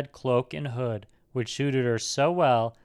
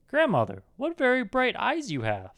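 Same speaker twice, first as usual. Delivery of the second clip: put-on high-pitched girlish amazement; surprised little girl; 11 semitones higher than usual. A narrator's reading. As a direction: marvel in a much higher girl's voice. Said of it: "surprised to see how strange".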